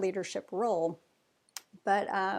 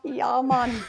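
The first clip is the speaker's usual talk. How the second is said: put-on quick joking Jamaican accent, a bit louder; 5 semitones higher, 7 dB louder than usual. Guest jokes about saying Yah, in a Jamaican accent. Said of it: "That was the [name]".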